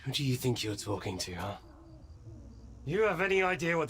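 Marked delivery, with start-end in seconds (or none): none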